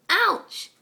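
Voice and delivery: sharp, high, loud yelp of pain; sudden ouch. Acted. Alicorn bumping into a statue in the dark, sharp yelp. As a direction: yelp as you bump into it.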